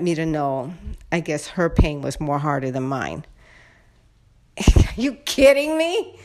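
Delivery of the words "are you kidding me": incredulous laugh. Real, not acted. Outraged at neighbor's attitude.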